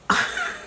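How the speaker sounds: high-pitched burst of laughter; real laugh. Real, not acted. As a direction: crack up at the memory. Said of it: "I shouldn't be laughing".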